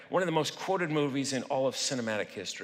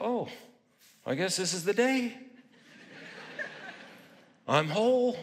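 mock realization; 8 semitones higher than usual. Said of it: "wake up this morning and go".

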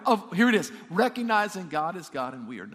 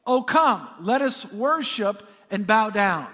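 loud, drawn-out psalm reading; ringing call to worship, stretched out; declaimed scripture; 2 semitones higher, 7 dB louder, slower than usual. Acted. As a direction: proclaim the verse to the congregation. Declaims a psalm verse to congregation.

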